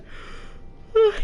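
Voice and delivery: long airy tired yawn; real yawn. Real, not acted. Tired streamer apologising for yawning, long real yawn. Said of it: "Sorry, I'm gonna yawn again".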